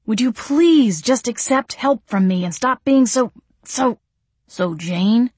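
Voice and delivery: exasperated plea; exasperated Gabriel. A narrator's reading. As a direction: voice of a fed-up man.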